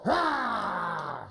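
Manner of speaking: big playful growling roar; wolf roar. Shown as a demonstration. Coach asking the wolf actor for a roar. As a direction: roar it out like a hammed-up hungry wolf. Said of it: "Wolf, can you go".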